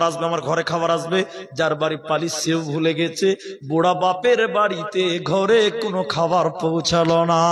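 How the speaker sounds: loud, slow, chanted sermon; full-force preaching. Acted. Preacher warning the crowd about neglected fathers, chanted preaching. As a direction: preach it with rising fervour, chanting the verses.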